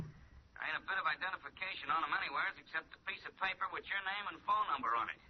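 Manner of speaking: tinny telephone voice; a police sergeant calling on the line; gruff sergeant through a phone receiver; over the phone. Acted.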